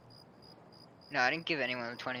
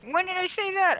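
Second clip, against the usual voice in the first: much higher, louder indignant protest; 9 dB louder than usual. Real, not acted. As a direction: defend yourself, offended.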